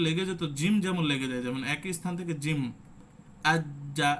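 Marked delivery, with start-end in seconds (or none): 3.5-4.1 আজ্জা with the jim stopped hard; আজ্জা clamped shut on the জ. Shown as a demonstration. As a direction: press the tongue firmly on আজ্জা. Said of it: "এই যে লেগে যাচ্ছে".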